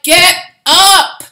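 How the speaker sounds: loud, high rallying cry from on high; slow, booming call to rise; commanding shout. Retold or imitated. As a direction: roar at them to get up. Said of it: "they're like".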